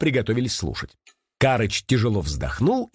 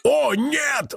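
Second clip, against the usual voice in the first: high cry of alarm; sudden cry; 13 semitones higher than usual. A narrator's reading. Karych snatching the suitcase away, crying out. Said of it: "вскричал вдруг Карыч".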